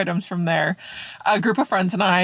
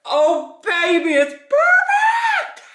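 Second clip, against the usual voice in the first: excited; 4 dB louder, much slower than usual. Real, not acted. Bread turns out perfect.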